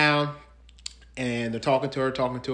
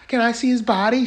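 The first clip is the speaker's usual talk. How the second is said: imitating pleading wife; 8 semitones higher than usual. Voices the wife pleading to police.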